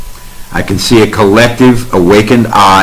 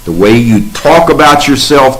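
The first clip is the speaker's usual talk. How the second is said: ordinary delivery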